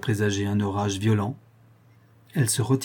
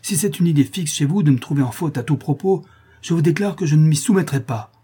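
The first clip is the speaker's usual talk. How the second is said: raised, loud, defiant protest; indignant outcry; 5 semitones higher, 5 dB louder than usual. A narrator's reading. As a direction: refuse to submit, voice pushed up. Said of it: "s'écria-t-elle".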